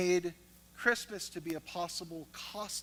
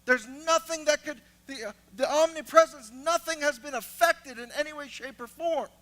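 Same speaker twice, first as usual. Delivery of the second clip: loud, higher preacher voice; full-force preaching; 8 semitones higher, 10 dB louder than usual. Acted. Preacher exhorting congregation at full force. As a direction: preach it, building energy.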